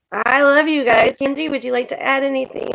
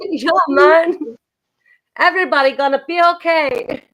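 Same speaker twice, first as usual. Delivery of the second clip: put-on higher Jamaican accent; 5 semitones higher than usual. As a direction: play it Jamaican and easygoing. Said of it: "get my Jamaican on".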